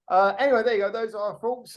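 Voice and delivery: usual speaking voice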